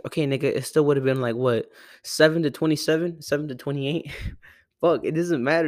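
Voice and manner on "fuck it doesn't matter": ordinary talk, nothing marked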